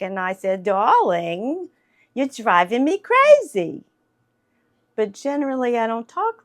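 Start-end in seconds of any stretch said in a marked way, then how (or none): 0.6-3.8 higher, louder theatrical New York wife. Acted. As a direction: play the fed-up stage wife from the counseling play. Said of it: "it was very New York".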